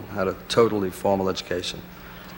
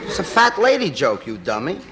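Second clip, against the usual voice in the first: mocking; 7 semitones higher, 4 dB louder than usual. Real, not acted. Mocking the critic's reading.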